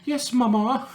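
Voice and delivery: put-on high, meek little-child reply; mock child voice. Mocking a spy's childhood, a high meek child's voice. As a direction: squeak it out like an obedient kid.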